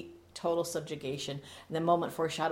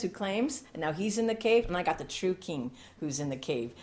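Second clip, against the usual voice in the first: usual speaking voice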